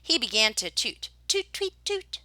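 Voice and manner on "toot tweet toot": high piping toots; whistle toots. Acted. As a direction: mimic a tin whistle up high. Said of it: "went the whistle".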